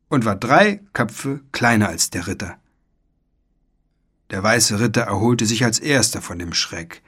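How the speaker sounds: usual speaking voice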